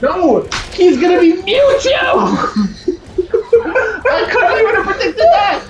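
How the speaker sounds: high, laughing shout of disbelief. Real, not acted. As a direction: laugh through the words, high up. Laughing at the casting news.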